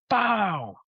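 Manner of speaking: high, falling "pow" of a kick; imitated impact noise. Acting out the jump kick.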